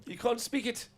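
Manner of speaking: high South African teasing; South African accent. Pretend. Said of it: "in our South African accents".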